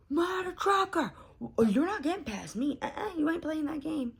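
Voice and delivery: high, loud mock-furious outburst; road rage. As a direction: yell it at a driver cutting in. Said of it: "I do have a little bit of road rage".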